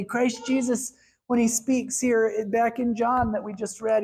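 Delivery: normal talking voice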